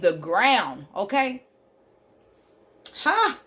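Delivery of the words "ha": high joyful whoop of praise; excited shout. Real, not acted.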